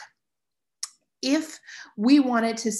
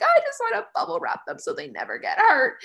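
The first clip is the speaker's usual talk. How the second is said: mock whine; 12 semitones higher than usual. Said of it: "I would be like".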